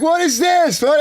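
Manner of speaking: high mock shout of alarm; freaking-out cops. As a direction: imitate a cop demanding an answer. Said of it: "They're, like, freaking out".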